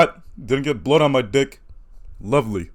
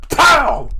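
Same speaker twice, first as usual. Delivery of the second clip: loud pained impact yelp; 13 semitones higher, 7 dB louder than usual. Retold or imitated. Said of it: "I went".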